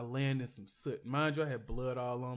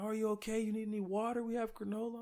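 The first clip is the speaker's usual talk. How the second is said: high-pitched voice of helpful passers-by; imitated concerned hikers; 8 semitones higher than usual. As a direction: offer help in a high, put-on voice. Imitating concerned hikers offering help.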